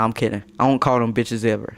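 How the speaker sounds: usual speaking voice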